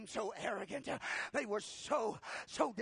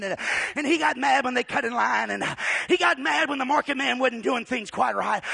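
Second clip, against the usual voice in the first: loud preaching narration; 3 semitones higher, 12 dB louder than usual. Acted. Preacher telling the nativity to the church, loud. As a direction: build the scene with fire.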